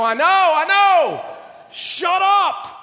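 mock outburst of a frazzled Peter; Peter snapping back. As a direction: yell at the rooster, fed up and frazzled. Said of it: "Peter was gonna go".

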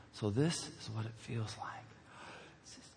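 very soft, low, savouring murmur; hushed awe. Retold or imitated. Picked-first kid gazing at the others, hushed awe. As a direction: drink in the moment, almost whispered.

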